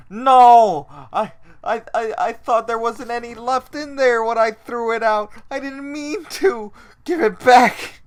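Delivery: high, loud, frantic plea; desperate pleading. Retold or imitated. Friend voicing Jake begging for his pudding cup back, high and frantic. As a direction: beg to keep the pudding cup, panicked.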